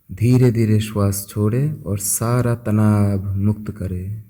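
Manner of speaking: soft, unhurried voice with long pauses; guided meditation. Acted. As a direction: guide listeners gently into stillness, phrase by phrase. (शांत, धीमे स्वर में ध्यान कराते हुए)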